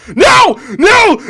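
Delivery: piercing high, loud shriek. A narrator's reading. DiCaprio kicking his chair across the room, shrieking. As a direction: shriek in a total meltdown. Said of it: "just starts shrieking".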